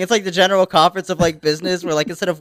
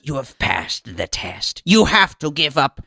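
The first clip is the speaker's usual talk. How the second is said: much lower, slow and soft proclamation; solemn elder; 7 semitones lower, 4 dB quieter, slower than usual. Acted. Elder welcoming the newcomer, low slow proclamation. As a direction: pronounce it like an ancient oracle.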